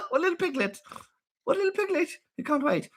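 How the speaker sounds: very high-pitched cutesy coo; squeaky cooing. Real, not acted. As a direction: coo at an adorable greedy pet. Cooing over greedy little monkey.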